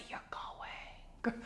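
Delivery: very soft, firm whisper; barely audible order to a husband; whispered command. Acted. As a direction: lay down the law in a whisper. Wife quietly overrules balking husband.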